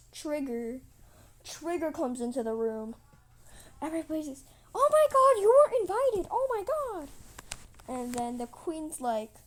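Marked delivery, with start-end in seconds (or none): 4.7-7.1 high, loud panicked squeal; shocked crowd outcry. Acted. Everybody reacting to the uninvited guest, shocked squeal.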